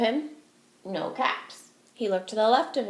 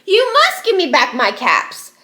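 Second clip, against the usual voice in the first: much higher and louder shout, slow on monkeys; angry shout; 8 semitones higher, 9 dB louder, slower than usual. Acted. Furious peddler shouts at the monkeys for his caps. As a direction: shout at them, furious and stamping. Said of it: "stamped both his feet and shouted".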